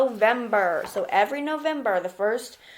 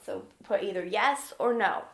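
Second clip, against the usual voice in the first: ordinary talk, nothing marked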